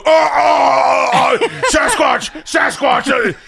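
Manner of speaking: loud, slow, flustered yelp; panicky Tor impression. Host mocks the producer dropping the phone, loud flustered cry. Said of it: "he'll go".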